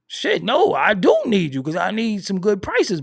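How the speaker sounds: bargaining with the dealer, loud protest. Retold or imitated. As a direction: protest loudly that you need the dealer. His retold loud reply.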